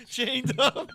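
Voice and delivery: loud high cackle breaking through the words; big laugh. Real, not acted. Comic cracking up at his own llama punchline, loud laughter. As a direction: burst out laughing mid-line.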